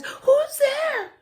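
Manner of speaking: squeaky startled high voice; high mouse voice. Acted. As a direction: squeak out a nervous question.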